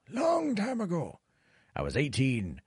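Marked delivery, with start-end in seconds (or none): 0.1-1.2 high, drawn-out reminiscing voice; wistful mock old-timer. Streamer mock-reminisces about his casino days in a lightly voiced old-timer tone. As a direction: stretch it like an old storyteller. Repeats phrase in old-man voice.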